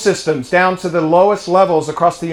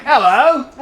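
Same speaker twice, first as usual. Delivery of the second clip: put-on British-accented hello, pitched higher; 5 semitones higher than usual. British man greeting his mom, British accent slipping out. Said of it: "you lose your accent only if".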